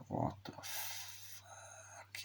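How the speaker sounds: breathy slow whispered disbelief; hushed baffled what-the-fuck; stunned whisper. Retold or imitated. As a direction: mouth it under your breath, slowly. Baffled reaction to long scene.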